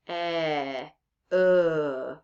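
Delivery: "e" and "uh" held alone, a pause between; lone vowel sounds "e" and "uh", each sustained; isolated vowel sounds. Shown as a demonstration. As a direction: draw out "e", then "uh", as bare vowels. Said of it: "Those are the vowel sounds".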